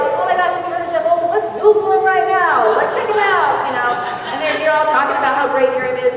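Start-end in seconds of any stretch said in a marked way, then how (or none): 0.0-3.7 high, urgent, hammed-up gushing; giddy new convert who can't wait; so excited. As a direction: gush it high and eager. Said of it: "so excited".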